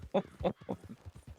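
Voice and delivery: rire soufflé aigu. Real, not acted. Rire après les secousses.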